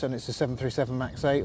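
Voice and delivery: usual speaking voice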